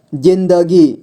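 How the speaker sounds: जिंदगी drawn out as जिं-द-गी; syllable by syllable. Shown as a demonstration. Teacher showing beginners how to read जिंदगी, syllable by syllable. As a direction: sound out जिंदगी in three steps. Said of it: "जोड़ जोड़ करके ही पढ़ना होगा".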